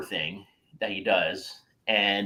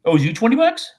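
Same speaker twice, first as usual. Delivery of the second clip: high, loud disbelieving echo of the question; loud, high surprised question; 12 semitones higher, 9 dB louder than usual. Real, not acted. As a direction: shoot the words back in sharp disbelief. Co-host reveals unpaid twenty bucks.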